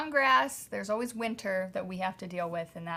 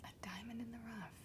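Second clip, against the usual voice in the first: hushed, quicker whisper; whispered echo; 3 semitones higher, 16 dB quieter, faster than usual. Pretend. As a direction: whisper it back to your partner. Whispered teasing echo of partner's phrase.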